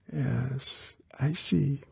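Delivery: small, high, very soft and grudging. A narrator's reading. Girl turned down for tonight, grudging. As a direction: give in reluctantly in a small girl's voice. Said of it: "The voice was grudging".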